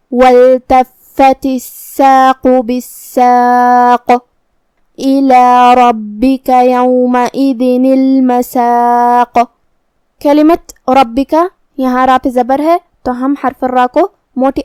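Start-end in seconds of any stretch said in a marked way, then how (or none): 0.1-9.4 slow melodic chant, قاف bounced at the stop; Quran recitation. Shown as a demonstration. Teacher recites the next verse, slow chant. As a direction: recite slowly, letters drawn out. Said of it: "حرف کو ہلاتے ہوئے یہ کلمہ کو پڑھیں گے".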